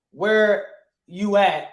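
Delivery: "where" drawn out slow and falling; where you at, said slow; stressed where. Shown as a demonstration. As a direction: lean into "where" slowly. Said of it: "How many wheres?".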